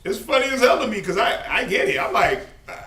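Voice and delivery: real laughter through loud, high words; laughing while admitting it. Real, not acted. Preacher laughs at his own example with the congregation, loud and high. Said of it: "I'm laughing 'cause it's funny".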